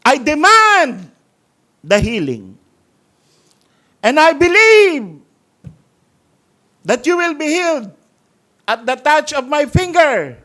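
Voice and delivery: mock televangelist commanding healing; mock faith healer. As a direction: parody a showy faith healer demanding a cure. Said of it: "Huwag tayo ganun mag-pray".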